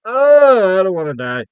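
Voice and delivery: high, loud yelp then a whimpering plea; a hammed-up frightened yelp; mock terror. Locked in a room with an alien, mock panicked yelp. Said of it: "I'd be like".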